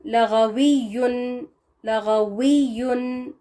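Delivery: लगवियुन said very slowly, each sound drawn out; slow demo. Shown as a demonstration. Teacher slowly modelling the word for the class. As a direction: stretch लगवियुन slowly with a clear w. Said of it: "यहाँ है".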